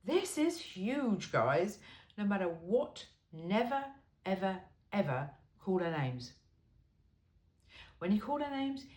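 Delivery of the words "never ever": ordinary talk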